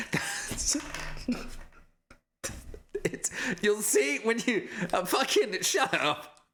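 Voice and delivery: high, cracking-up defensiveness; giggly, drawn-out mock indignation; laughing protest. Real, not acted. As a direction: try to argue while losing it laughing. Laughing while defending own drawing.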